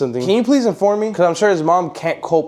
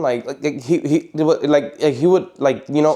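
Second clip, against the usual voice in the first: imitating a stutter; 4 semitones lower, slower than usual. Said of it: "Carlos had kind of a stuttering problem".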